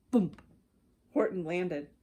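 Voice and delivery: ordinary delivery, nothing marked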